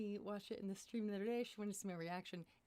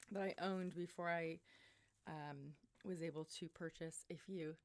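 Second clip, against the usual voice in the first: normal talking voice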